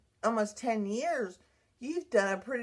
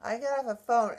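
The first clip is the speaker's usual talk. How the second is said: usual speaking voice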